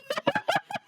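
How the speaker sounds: shrill laugh; high squeal of laughter. Real, not acted. Laughs at friend's joke.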